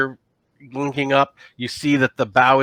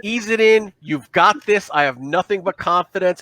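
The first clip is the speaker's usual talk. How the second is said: higher, gentle coaxing voice; soothing coaching; 8 semitones higher than usual. Acted. The Dralsite coaching Sylvie through docking, soothing. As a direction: talk the pilot in gently. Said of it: "he goes".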